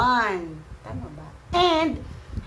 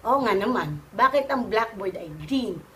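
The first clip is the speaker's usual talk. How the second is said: ordinary talk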